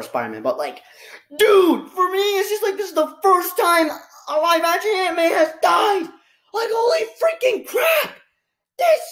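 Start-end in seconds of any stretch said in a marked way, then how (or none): 1.4-6.0 half-joking whimper, much higher; pitched-up, put-on sob voice; mock crying. Grieving Aunt May's death. 6.5-9.0 much higher exclamation; exclaiming. Real, not acted. Outburst over Aunt May's death.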